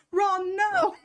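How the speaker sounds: high, giggly quoted cry; mock wail through laughter. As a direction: cry it out while still laughing. Reenacts clip while laughing.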